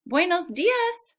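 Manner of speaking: high, loud, drawn-out greeting; calling out. A narrator's reading. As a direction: call a cheery hello down the street. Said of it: "Senor Enrico calls".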